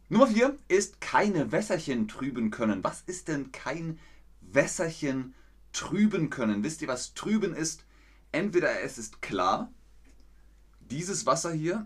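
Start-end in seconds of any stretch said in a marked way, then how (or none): none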